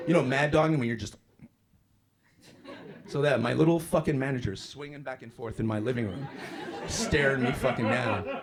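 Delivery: usual speaking voice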